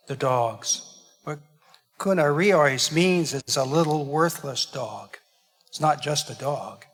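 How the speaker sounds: ordinary delivery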